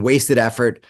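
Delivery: ordinary talk, nothing marked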